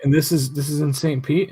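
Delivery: lightly voiced mom asking; curious mom. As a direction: check the detail eagerly.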